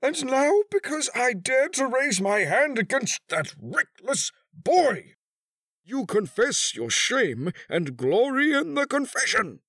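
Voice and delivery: very high, loud tirade with stretched key words; high-strung agony. A narrator's reading. Betrayed husband accuses his wife, high-strung fury. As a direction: rail at her, voice pitched right up in anguish. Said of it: "in the high-strung accents which, in crises of great mental agony".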